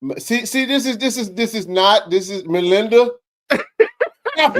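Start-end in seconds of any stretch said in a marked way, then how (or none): 3.5-4.6 a squeaky high "stop it"; mock-protest, high and loud; high playful stop. Real, not acted. Host teasing Melinda mid-banter, squeaky playful stop.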